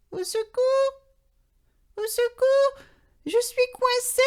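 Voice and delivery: very high, timid call for help; tiny voice. A narrator's reading. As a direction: squeak out a small cry for help. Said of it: "appelle d'une toute petite voix".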